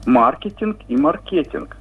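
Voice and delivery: маркетинг first with МА stressed, then with КЕ stressed; two stress variants. Shown as a demonstration. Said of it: "дают два варианта".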